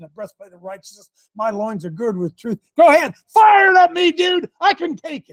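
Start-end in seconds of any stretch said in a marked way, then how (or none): 2.8-5.3 high, loud battle challenge; daring shout. Acted. Preacher daring the devil to fire at him, defiant shout. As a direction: bellow a dare at the attacker.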